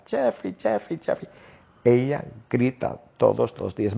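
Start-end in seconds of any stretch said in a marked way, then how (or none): 0.1-1.3 imitating the wife. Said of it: "Jennifer grita".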